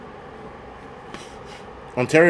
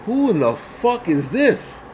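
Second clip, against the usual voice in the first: higher, slow, drawn-out baffled question; retold disbelief; 6 semitones higher, slower than usual. Biker on the highway, slow higher bafflement. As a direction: stretch it out in disbelief. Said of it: "And I'm like".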